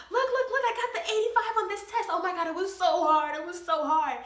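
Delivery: high, fast, laughing brag; excited kid showing off. Retold or imitated. Kid running home to show dad, high and excited. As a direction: show off the grade to dad, giddy. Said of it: "and I'm like".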